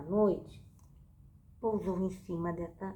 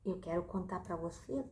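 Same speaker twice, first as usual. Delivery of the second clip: usual speaking voice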